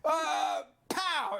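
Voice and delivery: high yelp then a punchy pow sound; mock scream and pow. Retelling the arm breaking, high mock yelp and pow. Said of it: "his whole arm is like".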